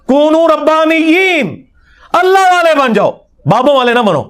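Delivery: high-pitched, stretched proclamation; ringing declamation. Acted. Preacher voices a prophet's call to the people, high declamation. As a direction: proclaim it high, holding the long vowels.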